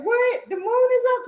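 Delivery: high startled disbelief; can't believe you missed it; squeaky high shock at the news; surprised. Retold or imitated. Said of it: "I said".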